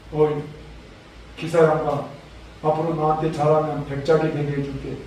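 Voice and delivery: low, lightly voiced royal promise; princely speech. Beggar-dressed prince promising his helper a title, low and princely. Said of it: "말하는 것이 진짜 왕자 같고".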